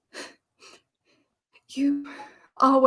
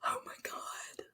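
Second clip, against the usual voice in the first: much softer, whispered through tears; a breathless, tearful whisper of panic; crying whisper; 15 dB quieter than usual. Acted. Winnie whispering in tears after kissing Daniel.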